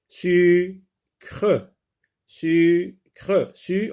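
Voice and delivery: "sucre" split as su, pause, cre; held su, then a separate cre in "sucre"; syllable by syllable. Shown as a demonstration. Teacher tapping syllables for pupils, "sucre" in two spaced beats. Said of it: "frappe les syllabes".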